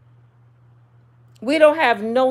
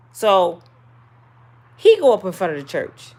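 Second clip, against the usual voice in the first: ordinary talk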